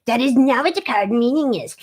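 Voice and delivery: prissy know-it-all correction; mock the pedantic expert; snooty know-it-all voice. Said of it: "Poindexters of tarot who wanna come in".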